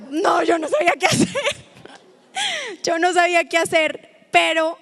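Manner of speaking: high, loud laughing outburst; laughing loudly. Real, not acted. Telling the stairs mishap, high loud laughter. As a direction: laugh through the words.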